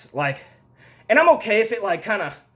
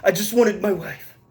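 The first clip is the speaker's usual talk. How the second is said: mock brooding hero; 3 semitones lower than usual. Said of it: "Did Jon Snow?".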